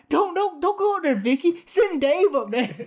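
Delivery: high, loud mock panic; comic begging, voice shooting up, laugh creeping in; scared kid pleading. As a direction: plead like a frightened child, hammed up. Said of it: "He's, like, scared".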